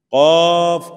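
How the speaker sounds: held 'قاف', heavy and rounded; letter demonstration. Shown as a demonstration. Teacher naming the confusable letter, loud heavy Q. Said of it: "کون سی مشابہت".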